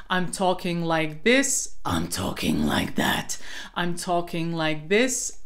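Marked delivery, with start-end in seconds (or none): 1.8-3.4 low growly rattled take; rattle voice. Shown as a demonstration. Coach shows the transfer exercise, rattle voice. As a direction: switch into a deep raspy rattle. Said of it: "try this transfer exercise in one breath".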